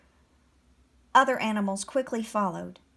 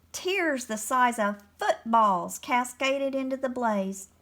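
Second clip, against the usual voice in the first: plain reading voice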